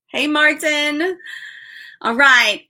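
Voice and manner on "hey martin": normal talking voice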